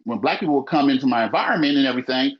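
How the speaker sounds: ordinary delivery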